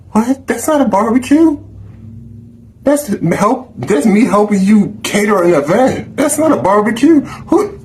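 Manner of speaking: indignant high-pitched protest; incredulous outrage. Real, not acted. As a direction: squeal in outraged disbelief. Refusing to help cater.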